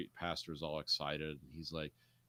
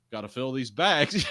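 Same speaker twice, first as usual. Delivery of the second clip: dismissive; 6 semitones higher, 10 dB louder than usual. Retold or imitated. Sergeant quoted, dismissive.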